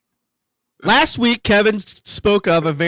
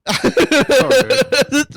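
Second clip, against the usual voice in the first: high sheepish cackle; long high laugh; 9 semitones higher, 3 dB louder than usual. Real, not acted. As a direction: burst into high-pitched laughter. Laughing at own mistake.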